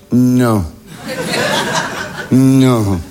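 low, drawn-out refusal to believe; slow-dawning horror, kept low; dawning disbelief. Acted. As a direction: drop low and stretch the word. Stunned at sight in the distance.

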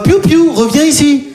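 high sing-song call to a dog; high dog owner call. Retold or imitated. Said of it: "j'entends une deuxième fois". (voix aiguë qui appelle son chien)

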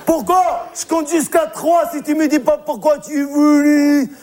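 high-pitched, hammed-up pleading demand; distraught scolding owner. Monsieur Seguin threatening his goat's bone, high mock distress.

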